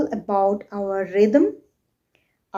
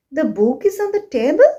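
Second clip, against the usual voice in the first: quick, high "The book is on the table", rising on TABLE; rising question; 5 semitones higher, faster than usual. Shown as a demonstration. Teacher repeating for learners, "The book is on the table" as a rising question. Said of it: "that means I'm asking".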